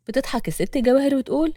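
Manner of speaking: ordinary delivery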